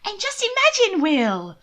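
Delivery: put-on high, loud, delighted young woman; eager sister pressing her case; excited sister. A narrator's reading. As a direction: burst out eagerly to win him over. Mina excited.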